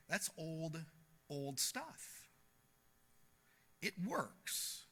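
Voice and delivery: ordinary delivery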